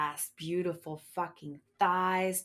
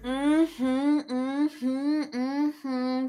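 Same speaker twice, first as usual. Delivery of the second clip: sensual mm-hmm; 8 semitones higher, 8 dB louder, much slower than usual. Acted. Sensual moaning agreement.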